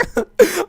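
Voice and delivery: loud high laugh; high burst of laughter. Real, not acted. Gamer laughing at his own story, loud high laugh. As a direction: crack up laughing at the memory.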